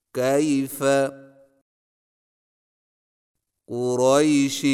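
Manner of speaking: کیف and قریش with a soft, unstretched ay; soft diphthong drill. Shown as a demonstration. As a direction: say each word gently, the ay not drawn out. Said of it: "صحیح تلفظ کے ساتھ ادا کیجیے". (یا لین نرمی سے، کھینچے بغیر)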